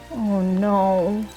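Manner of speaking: ordinary talk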